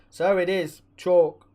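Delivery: ordinary delivery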